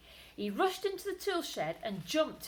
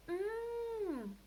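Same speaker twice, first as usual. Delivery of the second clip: mumbling mouse; 13 semitones higher than usual. Acted. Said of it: "was all she could mumble".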